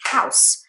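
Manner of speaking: "House" in one loud beat with a hissed S; one syllable. Shown as a demonstration. Teacher proves the point, one-syllable "House". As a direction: say "House" as a single syllable, crisp S ending. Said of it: "it's just one syllable".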